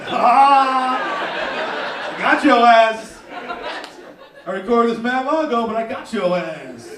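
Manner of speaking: loud, slow triumphant taunt with laughter; gloating hype man. Retold or imitated. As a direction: gloat like you pranked them. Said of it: "He's like".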